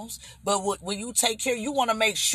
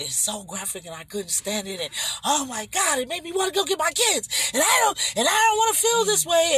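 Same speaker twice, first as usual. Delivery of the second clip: retelling a distressed daughter, building from steady to a much higher, louder near-shout; imitating her daughter's rising panic until she's almost yelling; upset daughter; 11 semitones higher, 3 dB louder than usual. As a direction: start upset and climb into a desperate outcry. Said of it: "and she was crying".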